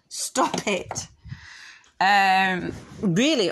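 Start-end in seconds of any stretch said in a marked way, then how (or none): 0.1-1.1 hushed, sharp whisper; whispered scold. Real, not acted. Owner whispering stop to the dog chewing wood. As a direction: hiss it at the dog.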